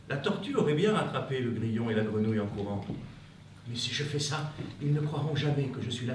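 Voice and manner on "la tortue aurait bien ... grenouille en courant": normal talking voice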